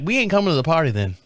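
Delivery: fast, flat-out refusal in Tennessee twang; mock Tennessee woman refusing. Neighbor's wife refusing to come to the party, Tennessee twang. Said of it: "she's got a little twang to her".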